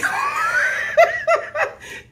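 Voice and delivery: high, loud burst of laughter; big real cackling laugh. Real, not acted. Laughs at his one-word answer.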